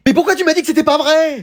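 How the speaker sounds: high-pitched indignant question; exasperated reproach. Acted. Parent misled by a false reassurance, exasperated. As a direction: demand why, pitch shooting up.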